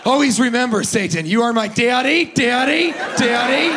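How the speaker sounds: high, loud fervent invocation building up; mock satanic prayer. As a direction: pray zealously, climbing into a whiny "daddy".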